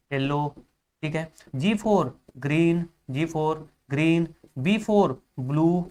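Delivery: very slow, word by word for note-taking; slow dictation. Shown as a demonstration. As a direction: dictate slowly so students can write. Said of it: "अब इसका full form जानिए". (धीरे-धीरे लिखवाते हुए)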